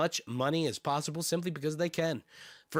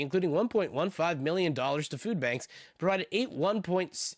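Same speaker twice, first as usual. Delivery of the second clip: ordinary talk, nothing marked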